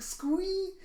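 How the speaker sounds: very high, tiny squeal; fangirl squee. Acted. Becky showing off her cardboard cutout, fangirl squee. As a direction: squeak it out in delight. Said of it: "She goes".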